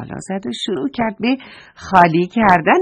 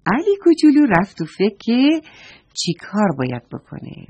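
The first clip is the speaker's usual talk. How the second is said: ordinary delivery, nothing marked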